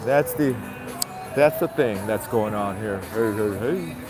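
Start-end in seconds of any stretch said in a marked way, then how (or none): none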